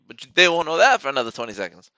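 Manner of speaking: gleeful taunt. Acted. Repeats the taunt louder.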